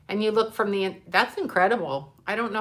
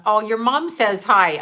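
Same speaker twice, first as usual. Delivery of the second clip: ordinary delivery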